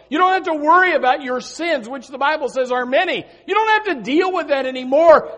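rousing revival preaching; fired-up preaching. Acted. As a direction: preach it out with full force to the church. Pastor preaching on peace.

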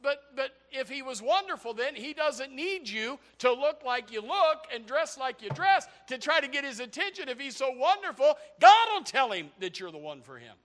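high, loud preaching shout; preaching at full force. Real, not acted. Preacher answering the girl's excuse, shouting high and loud. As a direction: thunder it out over the congregation.

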